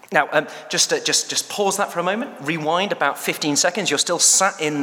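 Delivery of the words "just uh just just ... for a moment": ordinary talk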